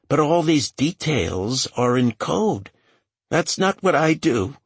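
high-pitched, anxious character voice; nervous young assistant. A narrator's reading. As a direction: answer questioning in a high, hesitant voice.

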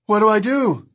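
high-pitched, anxious call; urgent shouted question. A narrator's reading. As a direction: call back over the engine, panicking. Panicked question shouted back on motorbike.

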